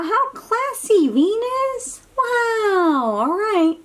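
high, delighted coo at the picture. Acted. Talking to the picture for a child, high delighted coo. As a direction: gush over the planet for the child. Admiring the cartoon planet.

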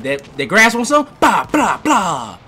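loud, high taunting with gun noises; mock taunt. Gamer taunting a dead player, loud and high. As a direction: taunt the target, then spit out mock gunfire. Taunting grass while shooting.

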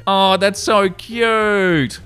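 slow, high, melting aww; gushing, tender coo; aww, so cute. Real, not acted. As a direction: stretch it out like you just saw a puppy. Reacts to wholesome post.

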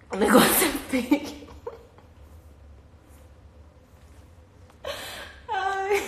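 high giggly squeal; laughing till it hurts; laughing hard. Real, not acted. Describing the ugly juice to viewers, squealing with laughter. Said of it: "Eu tô chorando".

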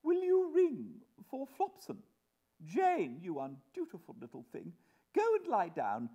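high, loud haughty orders; imperious mother. Acted. Mother dismissing undutiful Jane, imperious. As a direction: command them with ruffled dignity.

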